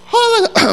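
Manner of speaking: loud high-pitched squawk; imitated nervous voice breaking upward; voice cracking high. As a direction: squeak out a failed first word, loud. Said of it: "My voice went all high".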